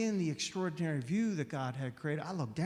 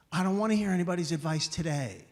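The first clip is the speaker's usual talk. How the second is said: ordinary talk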